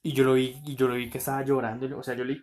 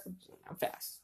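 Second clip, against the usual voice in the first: whispered aside; 15 dB quieter than usual. Real, not acted. Podcaster confiding a mean remark to co-host, whispered. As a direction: whisper it like a guilty secret. Gossipy aside about ugly friends.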